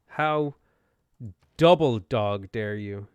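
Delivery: loud, drawn-out hammed-up indignation; mock outrage. Streamer scolding an enemy ship, loud mock outrage. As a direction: stretch double dog dare like a playground taunt.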